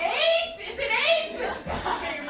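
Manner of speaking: hammed-up alarm; mock panicked shout. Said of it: "And I'm like".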